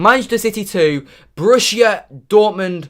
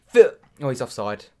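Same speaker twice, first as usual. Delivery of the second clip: ordinary talk, nothing marked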